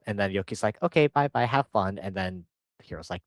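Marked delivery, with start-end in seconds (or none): 0.8-2.0 high, loud mock goodbye; acted-out sing-out farewell; breezy send-off. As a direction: wave them off in a raised voice. Acting a brisk send-off.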